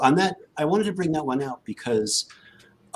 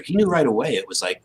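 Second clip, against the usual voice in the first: ordinary talk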